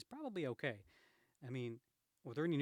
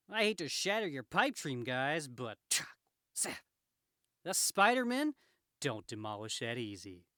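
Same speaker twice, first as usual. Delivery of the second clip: punchy, swinging superhero delivery; cocky Spider-Man; 9 dB louder, slower than usual. Acted. Spider-Man to crooks sure they've beaten him, cocky. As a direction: wisecrack like a hero bouncing back.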